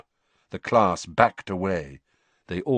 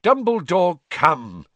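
higher, louder sharp reproach; angry protest; 9 semitones higher, 5 dB louder, slower than usual. A narrator's reading. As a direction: scold the headmaster. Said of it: "said Fudge angrily".

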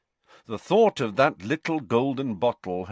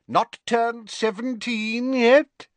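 put-on much higher, slow, jovial old teacher voice; a kindly old professor, unhurried; genial Slughorn; 4 dB louder, slower than usual. A narrator's reading. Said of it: "said Slughorn genially".

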